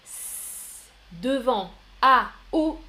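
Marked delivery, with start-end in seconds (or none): 0.1-0.8 "s" held as a long voiceless hiss; soft C sound. Shown as a demonstration. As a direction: draw out "s" on its own before going on. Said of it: "Si on veut prononcer le C". (« s » isolé, longuement sifflé)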